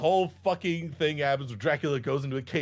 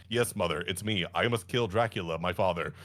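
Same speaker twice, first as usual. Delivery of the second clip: solemn son; 10 semitones lower than usual. Retold or imitated. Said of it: "He's like".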